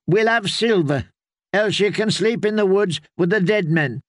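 put-on stubborn girl's voice; firm young girl. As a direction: hold out for payment as a tough girl. Willow insists on payment.

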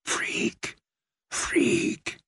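hissed, toneless whisper; ghostly repeated whisper; whispering reeds. A narrator's reading. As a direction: let the reeds whisper it cruelly. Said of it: "But the reeds said".

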